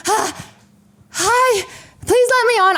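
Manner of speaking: high, whimpering mock plea; desperate plea. Traveler begging gate attendants, desperate and high. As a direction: beg in an exaggerated small high voice.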